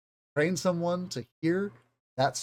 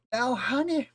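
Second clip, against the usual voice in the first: much higher, off-target Southern granny voice; botched old Southern lady; 10 semitones higher than usual. Acted. Teacher failing at an old Southern lady impression, too high. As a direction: overshoot a Southern old lady's pitch. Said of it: "that's not it at all".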